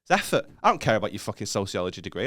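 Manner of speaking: ordinary talk, nothing marked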